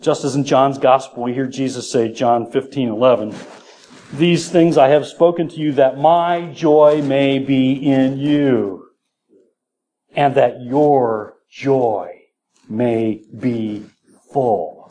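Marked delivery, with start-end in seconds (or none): 4.1-14.8 slow, weighty reading with spaced words; solemn scripture proclamation. Acted. Preacher reads Jesus's promise of joy, slow and solemn. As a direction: stretch each word and pause before the last.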